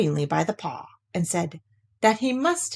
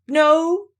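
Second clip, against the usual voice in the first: high, louder disguised "No"; change your voice to fool him; different voice; 9 semitones higher, 5 dB louder than usual. Acted. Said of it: "in a different sort of voice this time".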